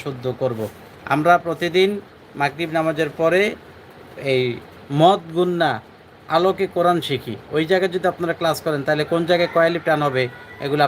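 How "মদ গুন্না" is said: ordinary talk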